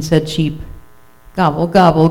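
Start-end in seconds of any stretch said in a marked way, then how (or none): none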